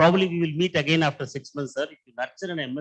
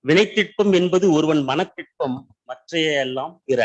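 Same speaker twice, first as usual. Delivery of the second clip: reciting Tamil verse; 4 dB louder, much faster than usual. Acted. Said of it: "from Tirukkural there is".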